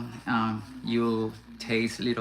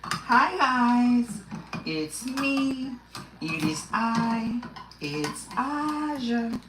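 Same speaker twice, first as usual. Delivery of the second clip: very high, slow squeaky character voice; squeaky mascot saying hello; high cartoon voice; 11 semitones higher, much slower than usual. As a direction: pipe it up like a little cartoon creature introducing itself. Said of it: "it's like".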